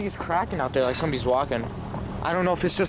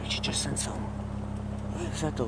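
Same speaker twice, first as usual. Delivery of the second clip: whisper; 3 semitones lower, 8 dB quieter than usual. Real, not acted. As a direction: whisper it in alarm. Startled by camera sound.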